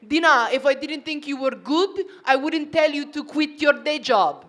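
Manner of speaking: imitating an Italian husband; Italian accent. Husband reassuring wife about quitting her job, Italian accent. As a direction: do his earnest Italian accent. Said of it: "he says it in the accent".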